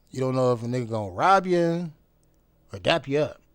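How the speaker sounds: ordinary talk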